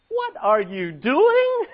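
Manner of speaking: slow, drawn-out casual question; modern Peter. Retold or imitated. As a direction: ask it like a puzzled friend, slowly. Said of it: "That's the vernacular".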